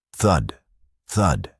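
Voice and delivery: ordinary delivery, nothing marked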